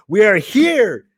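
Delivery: ordinary talk, nothing marked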